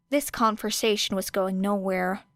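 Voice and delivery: ordinary delivery, nothing marked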